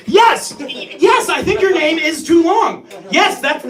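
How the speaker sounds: loud, high, indignant yes; worked up, defending yourself loudly; exasperated insistence. Acted. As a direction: shout back in a comic argument. Acted quarrel with Gwendolyn.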